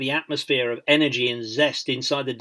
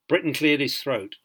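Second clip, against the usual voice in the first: ordinary delivery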